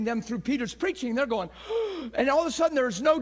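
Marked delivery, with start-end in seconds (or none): 1.5-2.1 acted sharp gasp of realization; shocked gasp. Crowd hearing Peter's preaching, mock shocked gasp. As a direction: gasp as the truth hits.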